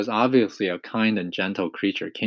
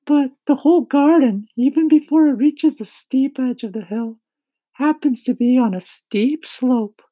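high-pitched, loud character voice; kindly ladybug; 8 dB louder than usual. A narrator's reading. Ladybug telling James of their departure, high, loud voice. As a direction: speak up high as an eager ladybug.

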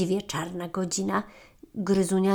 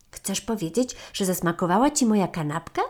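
ordinary delivery, nothing marked

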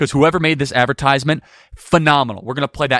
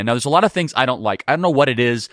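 ordinary talk, nothing marked

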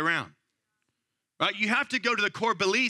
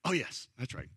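sheepish agreement; 9 semitones lower, 8 dB quieter than usual. Retold or imitated. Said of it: "I was like".

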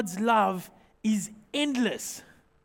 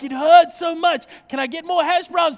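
louder and higher, hammed-up stuffed complaint; overfed and moaning yet asking for more; mock groaning shout; 5 semitones higher, 7 dB louder than usual. Pastor retelling the overstuffed buffet, hammed-up loud whine. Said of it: "I'm like".

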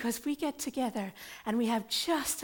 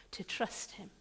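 whispered trust; 2 semitones lower, 8 dB quieter than usual. Acted. Hushed aside in sermon.

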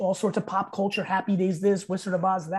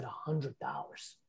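much softer, slow hundred dollars; hushed reveal; 6 semitones lower, 14 dB quieter, slower than usual. Retold or imitated. Fan reveals his start, hushed and slow. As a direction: drop to a near hush for the reveal. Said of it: "And he said".

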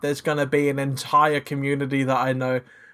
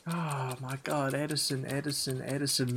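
muttering; 3 semitones lower, 12 dB quieter than usual. Real, not acted. Thinking aloud under his breath.

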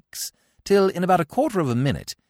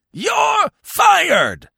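huge high furious bellow; 7 dB louder than usual. Acted. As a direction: blast it at the actor across the stage. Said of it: "bellowed old Blumenfield".

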